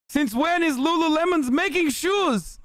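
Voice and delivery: baffled, in a put-on Middle Eastern accent; mock Taliban accent. Taliban recruiter baffled by Lululemon, mock Middle Eastern accent. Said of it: "The Taliban shows this compilation".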